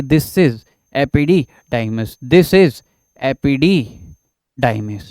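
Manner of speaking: epididymis very slow, syllable by syllable; dictating epididymis; slow dictation. Shown as a demonstration. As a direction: spell out epididymis slowly for notes. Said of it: "मैंने क्या बोला है".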